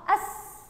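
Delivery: أس as one joined syllable ending on a held hiss; snatch the alef straight into the seen: أس. Shown as a demonstration. Teacher showing pupils a silent letter, أس snatched as one syllable. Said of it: "أخطفهم مع بعض".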